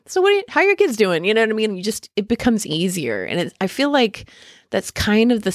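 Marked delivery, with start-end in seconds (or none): 0.1-1.2 high, quick party chatter; chatty small talk. Acted. Guest loosening up at a holiday party, chatty small talk. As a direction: pitch it up and rattle off friendly small talk.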